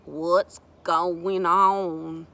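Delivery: loud, very drawn-out question; slow-talking newcomer. A narrator's reading. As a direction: amble in with a stretched-out drawl. Tiller arrives, man asking.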